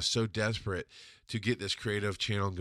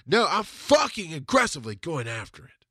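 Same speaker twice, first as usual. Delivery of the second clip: ordinary delivery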